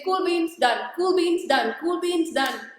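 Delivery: high, chirpy catchphrase, lightly voiced; imitating Frances. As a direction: toss off the catchphrase like Frances would. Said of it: "she'll say".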